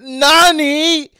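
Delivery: a hint of Kaido's shocked "Nani", high and loud; mock shocked villain. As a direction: lightly voice the villain's surprise. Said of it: "even Kaido said".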